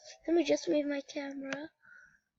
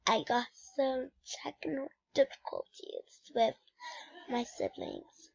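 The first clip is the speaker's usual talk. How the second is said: whisper; 8 dB quieter than usual. Real, not acted. Explains sibling interruption.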